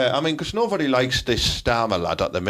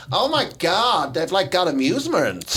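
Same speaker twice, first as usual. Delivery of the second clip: mock American college girl; 4 semitones higher than usual. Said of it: "'cause we're like".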